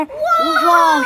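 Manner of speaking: very high, stretched-out squeal of joy; delighted squeal. Real, not acted. Child spots piles of chocolate in the shop, in a high delighted squeal. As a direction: squeal at the sight of treats.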